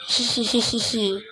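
soft sinister villain giggle; evil giggle. Pretend. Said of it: "You're not even evil".

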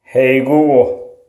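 ordinary delivery

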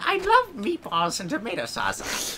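a hint of cartoon villain; lightly voiced villain. Villain confessing his failed plan, lightly voiced.

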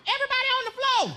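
high, thin hold-up call; high-voiced would-be stickup; high-pitched robber command. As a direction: order the bank down in a comically high voice. Failed robbery shout in high voice.

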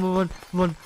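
normal talking voice

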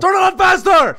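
high, loud yell; impatient shout. Real, not acted. Player rushing a friend starting the computer, impatient shout. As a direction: yell it to hurry them up.

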